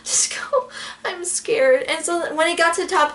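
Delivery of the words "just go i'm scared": frightened whisper; scared whisper. Retold or imitated. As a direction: whisper it like you are trembling at the top. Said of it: "I'm like".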